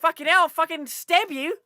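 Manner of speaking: high, loud mock-threatening Aussie yell; fake Australian accent. Game enemy threatens to stab, shouted fake Australian accent. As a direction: shout as a chav that comes out Australian. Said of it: "in my fake Australian accent".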